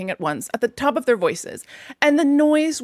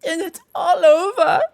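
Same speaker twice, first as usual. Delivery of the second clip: mock sob; 8 semitones higher, 5 dB louder than usual. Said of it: "sobbed the toad".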